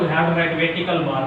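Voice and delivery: ordinary talk, nothing marked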